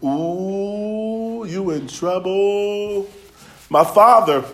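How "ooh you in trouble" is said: mock taunting tattletale. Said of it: "the first thing that somebody wants to say is".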